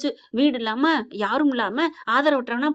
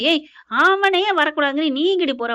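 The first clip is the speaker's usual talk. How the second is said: louder, higher mock shout; retold angry challenge; 4 semitones higher, 5 dB louder than usual. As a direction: block her with an indignant yell. Acted angry man blocking woman. (கோபமாக அதட்டுவது போல)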